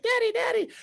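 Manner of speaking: put-on very high toddler squeal; piping child impression; excited little boy. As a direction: imitate a kid calling dad from the slide. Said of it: "He's like".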